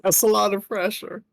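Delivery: a worried game character; lightly voiced worried character.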